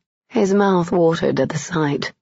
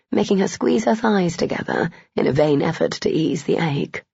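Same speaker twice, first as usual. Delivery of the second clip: ordinary delivery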